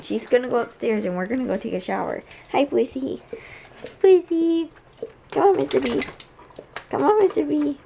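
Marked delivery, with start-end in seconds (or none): 2.5-7.8 higher, louder sing-song calling the dogs; pet voice. Real, not acted. Calling her puppies upstairs, high coaxing pet voice. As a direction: coax the puppies along in a bright call.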